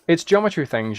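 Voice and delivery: normal talking voice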